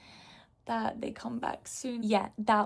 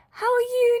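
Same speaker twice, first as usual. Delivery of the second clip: much higher and louder squeal; high fan shriek; 9 dB louder than usual. Retold or imitated. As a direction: shriek the greeting at him. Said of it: "so I was like".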